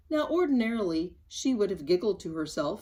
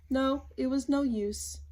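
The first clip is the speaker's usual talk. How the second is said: plain reading voice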